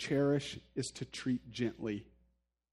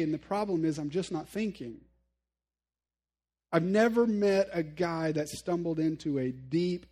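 ordinary delivery

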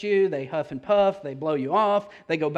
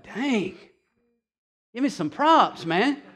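a hint of a miffed Peter, higher; mock Peter; 6 semitones higher, 3 dB louder, slower than usual. Peter wanting credit from Jesus, lightly voiced. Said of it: "Peter's like".

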